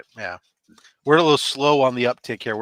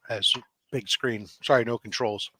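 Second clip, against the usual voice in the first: ordinary talk, nothing marked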